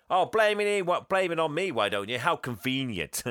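sarcastic retort. Retold or imitated. Fred snapping sarcastically back at Santa. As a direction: throw the blame back.

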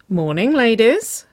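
loud, slow, rising sing-song greeting; smirking. A narrator's reading. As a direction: draw it out with a knowing smirk. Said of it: "Gus smirked".

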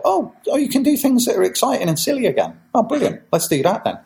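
high-pitched, eager realisation; mock-excited, pitched well up; acted delighted discovery. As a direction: play people suddenly thrilled by a new possibility. Acting out delighted industry reaction.

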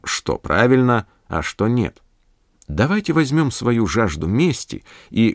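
usual speaking voice

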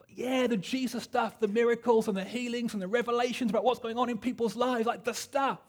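high, bright-eyed eager convert voice, slowing at first; eager new convert. Retold or imitated. Wimber telling the church leader he wants the Jesus stuff, high and eager. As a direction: play the keen new believer asking for miracles. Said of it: "And this leader was like".

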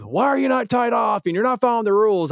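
high, loud angry scolding; irate scolding. Retold or imitated. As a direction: chew out the worker for breaking rules. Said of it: "just getting so irate".

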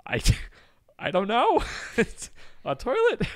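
high, bewildered, laughing disbelief; mock-baffled customer at a toilet store; baffled high squeak. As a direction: squeak it out like the answer is obvious yet you don't know. April bewildered at salesperson's question.

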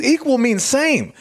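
high, slow, emphatic stand-up punch; retold comedian. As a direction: imitate a comic spelling it out, high and slow. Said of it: "He's a comedian".

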